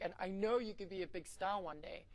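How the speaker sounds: usual speaking voice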